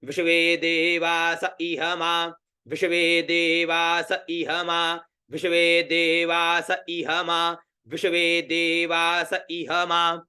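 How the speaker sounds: slow, even chant of विश्वे देवास इह मा; patient drilling of the mantra; slow repeated recitation. Shown as a demonstration. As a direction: repeat विश्वे देवास इह मा slowly, line after line. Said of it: "बार बार बोलकर उच्चारण करो".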